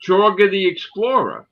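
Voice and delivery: high, loud Australian character voice; Jorga the Explorer. Acted. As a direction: announce the explorer with a put-on accent. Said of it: "I tried to do the character".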